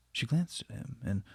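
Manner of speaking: usual speaking voice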